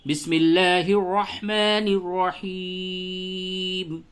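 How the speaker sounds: slow, drawn-out tajweed chant; قرآنی تلاوت کا لحن; Quranic recitation. Acted. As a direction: recite it melodically, stretching the vowels.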